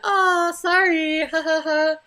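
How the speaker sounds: high, slow, sarcastic sing-song sorry and fake laugh; mocking fake apology. Mocking the man who accused her, in a high, slow sarcastic voice. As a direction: sneer at his breezy apology, stretched out high.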